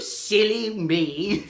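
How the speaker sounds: ordinary delivery, nothing marked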